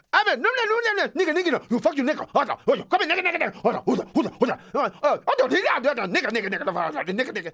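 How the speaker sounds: put-on high, fast accented slang; Ethiopian kid trying to be American. Ethiopian teen on the bus talking fast in an Ethiopian accent. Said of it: "they got that".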